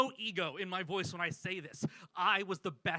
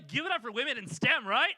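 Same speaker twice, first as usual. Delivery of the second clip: loud, higher rallying cry; emcee-style call for applause; 5 semitones higher, 7 dB louder than usual. Real, not acted. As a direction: whip up the crowd. Calling for audience applause.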